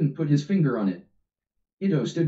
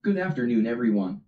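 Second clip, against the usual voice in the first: ordinary delivery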